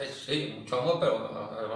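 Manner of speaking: ordinary talk, nothing marked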